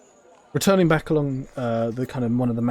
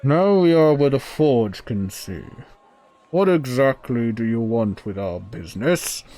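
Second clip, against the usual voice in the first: loud, raised-pitch interrogation; imperious forge dweller; 6 dB louder than usual. Acted. Forge dweller questioning the visitor now inside, loud and imperious. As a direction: demand an answer in a booming character voice.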